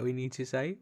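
mock-patient whisper. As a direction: coax him softly like a child. Mocking friend as forgetful.

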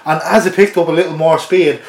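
ordinary talk

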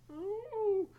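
long high-pitched anxious "ooh"; mock whine of dread, held high; high dread moan. Acted dread before phone call.

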